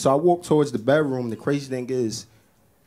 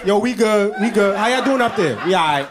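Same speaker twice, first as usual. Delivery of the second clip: louder higher calling back; gum chatter; 7 semitones higher, 4 dB louder than usual. Pretend. Gum pieces calling to each other, loud. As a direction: answer up as the gum.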